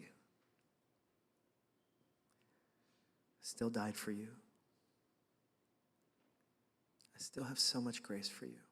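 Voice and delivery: soft, slow, gentle and loving; tender divine voice. Retold or imitated. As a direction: speak grace quietly like a loving father. God's loving voice to him.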